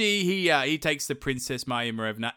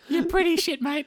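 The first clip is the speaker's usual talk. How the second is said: very high squeaky damsel; princess voice. Retold or imitated. Maya Marevna scolds Ivan in a squeaky high damsel voice. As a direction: fret at the useless prince, pitched way up.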